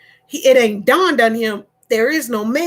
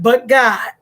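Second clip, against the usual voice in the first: wailing it out loud; loud, tearful mock wail; loud crying complaint; 4 dB louder than usual. Husband whining loudly to his wife.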